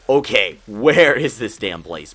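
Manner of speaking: loud, slow mock outburst; exasperated. Lost brothers on the hill, loud retold exasperation. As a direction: retell exasperation loudly, drawing the words out.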